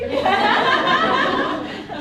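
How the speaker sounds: high, loud laugh; real laugh. Real, not acted. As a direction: laugh at the brother's jab. Laughs after brother quote.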